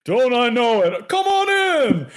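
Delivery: high, loud hearty Santa welcome; jolly Santa. Acted. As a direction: laugh it off and wave them inside. Santa replies, laughing.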